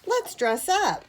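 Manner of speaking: put-on high, drawn-out child's suggestion; eager invitation. A narrator's reading. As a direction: propose it with playful eagerness. Child proposing a game in story.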